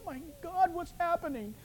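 high, hushed mock panic; alarmed aside. Bewildered preacher grabbed by a weeping grandma, hushed alarm. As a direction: gasp it softly, pitched right up. Retells his own frightened reaction.

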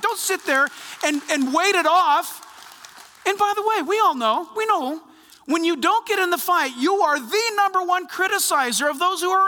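loud, high fiery exhortation; rally the church to fight; preaching at full force. Real, not acted. Exhorting the congregation to act.

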